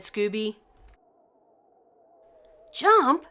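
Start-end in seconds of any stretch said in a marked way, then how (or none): 2.7-3.3 high, loud urgent yell. A narrator's reading. Shaggy telling Scooby to jump, a high loud urgent yell. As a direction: shout it as you leap. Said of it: "Shaggy yelled".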